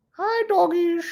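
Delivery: high sing-song voice for the dogs; high cooing pet voice. Real, not acted. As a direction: coo at the dogs like babies. Greeting the dogs in the room.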